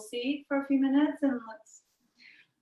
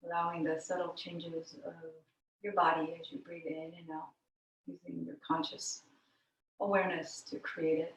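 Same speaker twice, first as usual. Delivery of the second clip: guided meditation; 5 semitones lower, 6 dB quieter than usual. Real, not acted. Yoga teacher guiding students into the breath, meditative. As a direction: lower and quieter, easing them gently into stillness.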